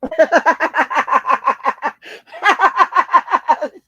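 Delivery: long loud cackling laugh. Real, not acted. After the Debo line, loud cackle. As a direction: let out a big rolling laugh.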